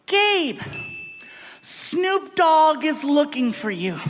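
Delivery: lightly voiced female publicist with big news. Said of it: "she's like".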